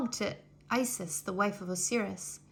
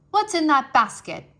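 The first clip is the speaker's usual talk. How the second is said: loud, higher, imperious demand; 4 semitones higher, 9 dB louder than usual. A narrator's reading. Pharaoh's daughter to her maids, spotting the basket, loud imperious demand. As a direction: demand it like a royal giving orders. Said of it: "she demanded".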